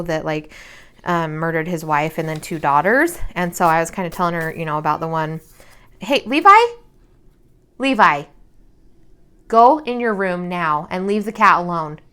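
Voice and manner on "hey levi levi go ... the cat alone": calling out. Real, not acted. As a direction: call him off from across the house. Mom calling son off the cat.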